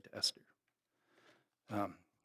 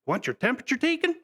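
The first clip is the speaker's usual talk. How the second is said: imitating a teasing call, higher and quick; mock the rancher's joke, pitched up and fast; teasing father-in-law; 13 semitones higher than usual. Father-in-law teasing Paul with the probe, higher and quick. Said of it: "and he says".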